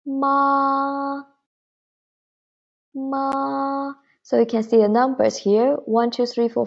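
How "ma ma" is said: MA held high and level; long MA on one high, level pitch; high flat tone. Shown as a demonstration. Teacher showing the first tone, ma high and flat. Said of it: "the high and flat tone".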